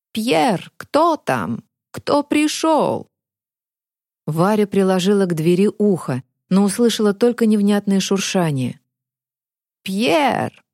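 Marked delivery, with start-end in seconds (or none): 0.1-3.1 put-on high, slow, cracked old man. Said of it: "надтреснутый старческий голос". 9.8-10.6 put-on high cracked old man calling. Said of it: "надтреснутый старческий голос".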